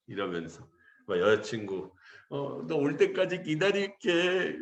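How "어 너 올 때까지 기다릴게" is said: higher, slow, drawn-out mock weepy voice; mock tearful promise. Girlfriend to departing recruit, mock tearful. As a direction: imitate a weeping girlfriend promising to wait. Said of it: "막 그러면서 울면서".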